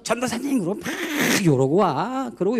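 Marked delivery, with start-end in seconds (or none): 0.0-0.6 높은 목소리로 반갑게 부르는 흉내; 멀리 있는 전도사를 부르는 아이처럼; 멀리서 부르는 아이 흉내. Retold or imitated. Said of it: "저 멀리서부터". (멀리서 아이가 부르듯 높게)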